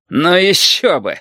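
high, drawn-out indignant scoff; flaring up. A narrator's reading. Valentin scoffs at the captain's powers, flaring up. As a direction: flare up at the officer. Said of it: "взвился Валентин".

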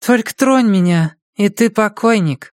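high-pitched woman, issuing a sleepy threat; high female character voice; drowsy threatening woman. A narrator's reading.

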